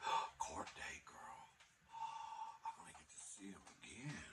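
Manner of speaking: breathless secret whisper with gasps; whispered inner thought. Retold or imitated. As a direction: gasp and whisper it to yourself, thrilled. And in my mind, I'm thinking.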